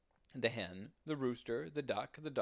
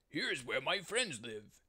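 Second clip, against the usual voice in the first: lightly raised pig voice; 3 semitones higher than usual. Acted. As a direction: pig proudly showing his farm. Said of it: "said the pig".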